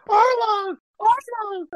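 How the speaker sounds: high, strained imitation of the cry; anguished Nami. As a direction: cry out the name in mock anguish.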